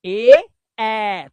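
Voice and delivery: ए, ए called out loud and drawn open; vowel demo. Shown as a demonstration. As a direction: show the open ए sound loudly. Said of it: "मुंह देखो मुंह".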